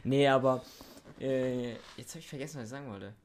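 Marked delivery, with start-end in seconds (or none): 2.0-3.1 trailing off. Real, not acted. Forgets what he wanted to say.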